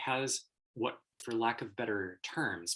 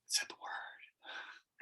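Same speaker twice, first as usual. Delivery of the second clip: slow, drawn-out whisper; scandalized whisper; 9 dB quieter, much slower than usual. Acted. Lecturer acting the shock at a swear word, whispered. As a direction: whisper it like something forbidden.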